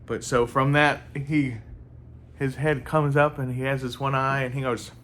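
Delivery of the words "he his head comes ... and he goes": plain reading voice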